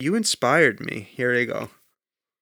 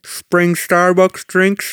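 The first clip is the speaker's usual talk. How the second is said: slow, loud, lisped through grills; gold teeth lisp; 5 semitones higher, 5 dB louder, much slower than usual. Pretend. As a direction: talk around fake gold teeth. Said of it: "I can't understand you".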